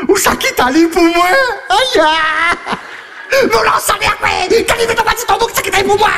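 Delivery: voix aiguë qui crie sa colère; dispute criée. Acted. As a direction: hurle sur lui, indignée. Dispute jouée avec l'épouse. (l'épouse furieuse crie, voix très aiguë)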